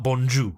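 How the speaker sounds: 'bonjour' as bon-joo, R dropped; silent final R. Shown as a demonstration. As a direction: end 'bonjour' on an oo with no R. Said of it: "That's not how it's said".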